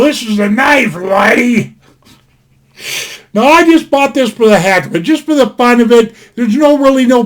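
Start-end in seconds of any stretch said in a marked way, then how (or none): 0.0-1.8 attempted Aussie accent, called out; half-hearted Australian accent on the famous line; Crocodile Dundee impression. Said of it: "I can't do the Aussie brogue".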